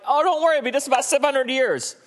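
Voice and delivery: very high, breezy and dismissive; breezy God. Retold or imitated. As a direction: brush off the worry lightly. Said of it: "he's like".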